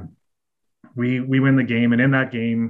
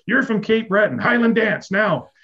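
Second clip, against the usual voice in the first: mock bossy coach order; 9 semitones higher than usual. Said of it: "He goes".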